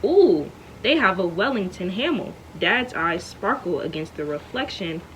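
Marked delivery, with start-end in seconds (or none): none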